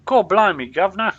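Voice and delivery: put-on over-the-top fictional-England London accent; hammed-up London accent. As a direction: belt out a stagey London accent. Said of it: "Is that a London accent?".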